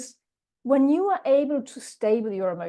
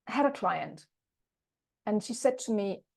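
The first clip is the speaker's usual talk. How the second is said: normal talking voice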